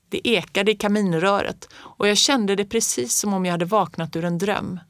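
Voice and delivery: plain reading voice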